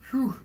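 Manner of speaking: high whew from the burn; spicy food. Real, not acted. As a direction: blow out the heat with a sharp whew. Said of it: "my tongue is on fire".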